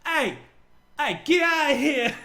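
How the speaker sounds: high, loud irate heckle; mock New Yorker shout. New Yorkers yelling up at the gates of hell, mock outraged shout. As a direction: holler it like an annoyed New Yorker. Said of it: "imagine, like, New Yorkers just".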